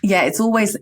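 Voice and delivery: ordinary talk, nothing marked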